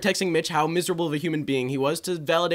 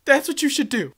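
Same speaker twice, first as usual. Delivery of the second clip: a hint of an indignant girl, louder and higher; 5 semitones higher, 5 dB louder than usual. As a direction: lecture them like a wronged brat. Girl scolds them.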